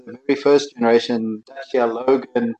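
ordinary talk, nothing marked

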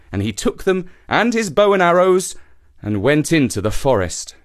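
ordinary delivery

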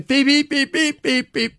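high beep beep imitation; taxi hooter beeps. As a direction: mimic a minibus taxi hooter. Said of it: "minibus taxis sound like that".

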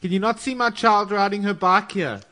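high-pitched nagging parent voice; fussy neighbour. Pretend. Joining the joke, a high fussy parent complaining about the street. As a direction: scold the passing drivers.

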